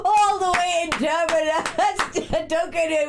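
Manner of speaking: high, laughing announcer outro; laughing host. Real, not acted. Host thanking Auntie Sheila before the break, laughing. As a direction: announce it through real laughter.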